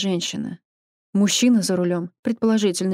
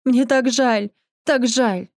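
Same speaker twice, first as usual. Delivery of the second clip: high, louder grieving plea; anguished cry; 4 semitones higher, 3 dB louder, slower than usual. A narrator's reading. Husband kneeling by his dying wife, anguished. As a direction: cry out the apology in grief.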